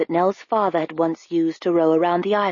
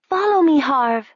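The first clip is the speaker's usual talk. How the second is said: calling out; 8 semitones higher than usual. A narrator's reading. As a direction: call up to a friend above. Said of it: "she cried".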